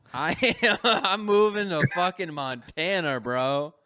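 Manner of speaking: loud, high, drawn-out excitement; hyped up. Real, not acted. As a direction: blurt it out, thrilled and half laughing. Hyped after watching Yellowstone.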